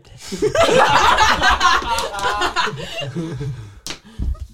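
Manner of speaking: loud high cackle; high laughing burst. Real, not acted.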